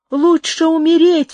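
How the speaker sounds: crying out defiantly while struggling for breath; breathless cry. Acted. Amaranta defies Carlos, a desperate shout. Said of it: "вскричала она, задыхаясь".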